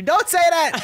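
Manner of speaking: high loud whine; childish protest. Retold or imitated. Father mimicking her as a child, loud and high. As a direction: shriek it like a cranky kid. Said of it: "He's like".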